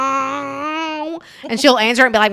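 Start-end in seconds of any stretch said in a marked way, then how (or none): 0.0-1.2 weird mom hello. Retold or imitated. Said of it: "make weird noises".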